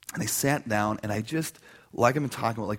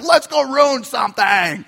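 higher, slower, playful troublemaker voice; mischievous guy voice; 12 semitones higher, 12 dB louder, slower than usual. Retold or imitated. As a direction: savor the bad idea. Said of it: "that's how we feel".

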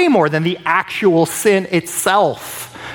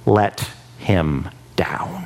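slow, unvoiced whisper of regret; hushed whisper; 8 semitones lower, much slower than usual. David confessing he let God down, slow whisper. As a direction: breathe the words out slowly.

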